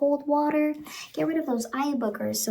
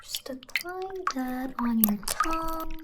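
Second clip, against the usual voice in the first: usual speaking voice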